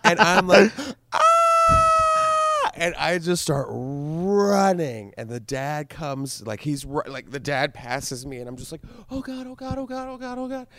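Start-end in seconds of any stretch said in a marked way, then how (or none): 1.1-2.7 high piercing shout of panic; retold high yell of terror; mock panicked scream. Kid screams after hitting girl. 9.1-10.7 freaking out. Retold or imitated. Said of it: "And I'm freaking out".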